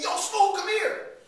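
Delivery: high, loud summons; calling over. Acted. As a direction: hail him across the street. Acted dealer calling him over.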